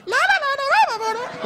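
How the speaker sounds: very high-pitched anime babble; imitating a sky-high cartoon falsetto; crazy cartoon voice. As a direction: squeak it out like an anime character. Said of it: "high, crazy cartoon pitch".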